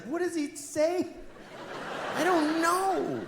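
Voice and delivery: put-on high childish question; a hint of a curious daughter; lightly voiced child. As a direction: ask like a small girl. Daughter and father exchange.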